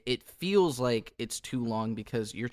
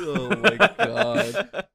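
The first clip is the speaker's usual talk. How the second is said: laughing; 7 semitones higher, 9 dB louder, much slower than usual. Real, not acted. Laughing at the post-hardcore joke.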